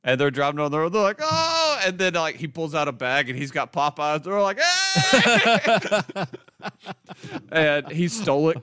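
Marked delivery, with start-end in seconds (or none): 1.2-1.8 high, loud imitated "oh" from a getaway car; retold group whoop, pitched way up; mock yell. Imitating men yelling in speeding car. 4.6-6.2 very high, loud "hey" breaking into laughter; mock cheer. Imitating cheer on seeing Popeyes.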